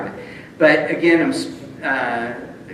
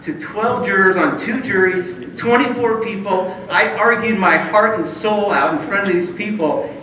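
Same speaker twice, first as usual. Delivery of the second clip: louder, slow, emphatic; exasperated rant; 6 semitones higher, 5 dB louder, slower than usual. Retold or imitated. Venting to Ron about the jurors, exasperated. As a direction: press every point hard.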